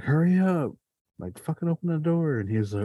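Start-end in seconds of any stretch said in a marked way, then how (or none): 0.0-0.7 high, pressed mock urgency; urgent retold plea. Trapped friend urging the guy at the door, high and urgent. 1.2-2.4 high-pitched mock panic; urgent retold demand. Trapped teen pushing the guy to open the door, high and frantic.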